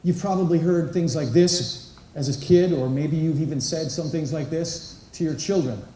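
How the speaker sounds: ordinary talk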